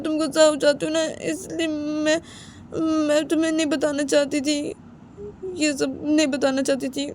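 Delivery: put-on pleading girlfriend. Girlfriend pleading with angry boyfriend, high and emotional.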